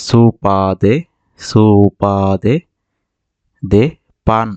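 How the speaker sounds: very slow, each syllable of সুপা দে drawn out; slow demo. Shown as a demonstration. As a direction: spell out সুপা দে slowly for learners. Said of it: "slow model sentence for learners".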